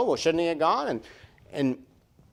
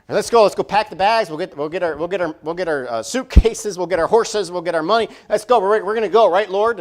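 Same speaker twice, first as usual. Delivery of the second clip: higher and louder, rushing to go; fired up to pack and leave now; eager excitement; 5 semitones higher, 6 dB louder than usual. Retold or imitated. Disciples eager to leave at once, loud and excited. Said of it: "you're like".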